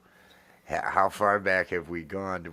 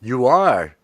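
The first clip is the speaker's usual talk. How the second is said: louder, higher surprise; surprised; 5 semitones higher, 13 dB louder than usual. Real, not acted. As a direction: react with disbelief. Surprised by love confession.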